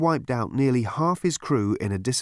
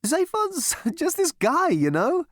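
much higher, slow, chuckling breeziness; bright, with a laugh; slower than usual. A narrator's reading. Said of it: "said Halfront brightly".

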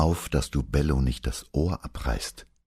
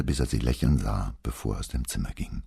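plain reading voice

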